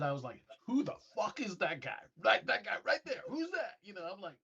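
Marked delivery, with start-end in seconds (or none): 0.7-3.8 very excited. Retold or imitated. Airman spots a mystery blip on radar, excited, high-pitched questioning. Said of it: "I was so excited".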